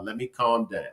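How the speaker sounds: soft, sinking plea to herself; calming down. Retold or imitated. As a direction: let it fade, calm yourself.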